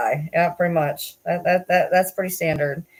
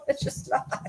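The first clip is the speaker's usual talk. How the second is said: whispered through laughter; breathless giggle on the words; laughing; 8 semitones higher, 7 dB quieter, slower than usual. Real, not acted. Laughing at own joke.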